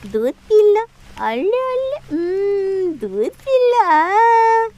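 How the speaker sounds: put-on high, sing-song baby talk, drawn out slowly; baby talk coaxing. Nanny coaxing baby Pari to drink milk, high sing-song baby talk.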